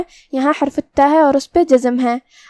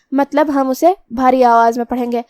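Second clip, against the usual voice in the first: normal talking voice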